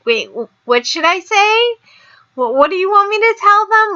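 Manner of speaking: put-on high, breathless Valley Girl convert; flustered ditzy girl asking what to say. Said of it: "talking Valley Girl".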